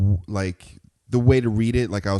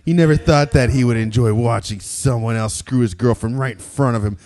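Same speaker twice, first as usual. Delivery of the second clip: dramatic reading; 3 semitones lower than usual. Acted. Host reading erotic fan fiction aloud, mock-serious narration. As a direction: read it like a serious romance novel.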